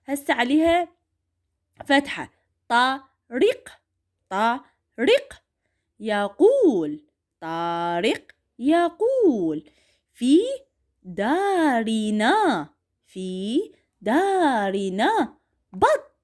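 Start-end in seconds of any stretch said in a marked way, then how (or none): 2.7-9.7 put-on طارق يقول stretched out slowly for children; drawn-out classroom reading; slow model reading. Shown as a demonstration. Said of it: "راح اقروا وياي". 10.1-16.1 في دارنا بط read very slowly word by word; slow model reading. Shown as a demonstration. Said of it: "راح اقروا وياي".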